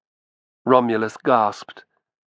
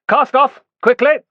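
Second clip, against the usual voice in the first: much higher, louder barked command; shouted order; 13 semitones higher, 7 dB louder than usual. A narrator's reading. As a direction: order the crew off at once. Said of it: "the shouted order".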